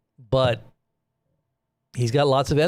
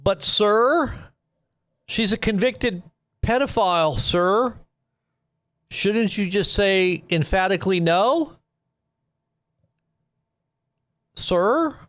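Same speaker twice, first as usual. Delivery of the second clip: higher, pointed voice pressing the president; mock reporter pressing; 7 semitones higher, slower than usual. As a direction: play a reporter needling him with sir. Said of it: "He likes to be called sir".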